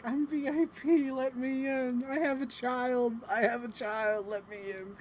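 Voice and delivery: high, pleading whine; high pleading cry to get past the line; mock pleading. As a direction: beg your way in like a desperate fan. Pleading to be let in.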